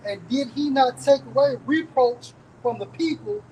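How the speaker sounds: scripture reading. Real, not acted. Reader proclaims David's deeds from Ecclesiastes to back up teacher, scripture reading. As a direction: read the verse out with measured, ringing weight.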